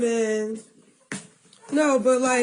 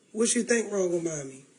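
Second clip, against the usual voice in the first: emotional; 6 semitones lower, 4 dB quieter than usual. Real, not acted. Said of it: "I'm getting emotional talking about it".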